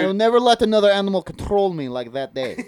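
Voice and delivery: high, emphatic mock Russian; hammed-up Russian storyteller; Russian accent. Telling a cat story on request, high emphatic Russian accent. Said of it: "tell me a story in Russian".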